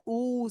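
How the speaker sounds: ऊ stretched as in zoo; long OO. Shown as a demonstration. Teacher contrasting double-O sounds, long ऊ. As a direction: hold ऊ long. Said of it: "long ओ का sound".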